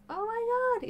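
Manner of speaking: very high squeal of joy; excited squeal. Retold or imitated. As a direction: squeal with delight.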